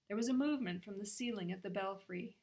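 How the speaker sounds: usual speaking voice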